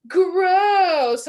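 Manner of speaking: high, loud mock disgust; mocking kids. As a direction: sneer at the smelly lunch like a child. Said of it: "it was like".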